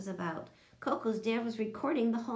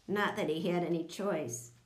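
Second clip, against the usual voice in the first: plain reading voice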